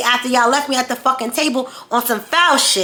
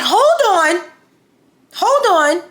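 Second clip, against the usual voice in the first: retold calling out; 8 semitones higher, 3 dB louder, much slower than usual. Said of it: "They're like".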